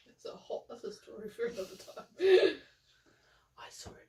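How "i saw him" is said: whispering. Real, not acted. Gossiping about him within earshot.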